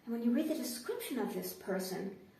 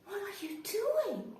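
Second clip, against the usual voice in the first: much higher and slowed down; incredulous question; 9 semitones higher, 4 dB quieter, slower than usual. Retold or imitated. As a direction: ask it in baffled disbelief. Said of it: "I'm going".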